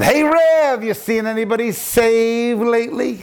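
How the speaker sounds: high, jeering needle at the pastor; a retold sneering jab; mocking taunt. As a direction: poke fun at the preacher in front of friends. Man taunts pastor in coffee shop.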